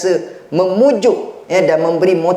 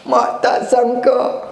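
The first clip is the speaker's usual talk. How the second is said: tearful mother; 8 semitones higher, slower than usual. Retold or imitated. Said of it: "mak teriak".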